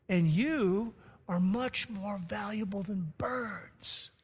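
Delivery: "valuable" drawn out, slow and soft; slow down and lean into "valuable"; key phrase slowly. Retold or imitated. Preacher quotes Jesus' key phrase to the congregation, slow and soft. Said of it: "he adds this key phrase".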